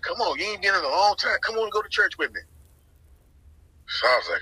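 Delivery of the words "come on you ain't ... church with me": mom coaxing. Retold or imitated. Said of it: "She's like".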